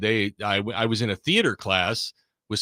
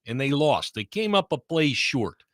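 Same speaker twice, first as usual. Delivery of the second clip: put-on Chicago accent; 4 semitones higher than usual. Recapping the Bears-Packers game for the host in a thick Chicago accent. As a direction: play a Chicago sports guy recapping the game. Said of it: "that was a very well done accent there".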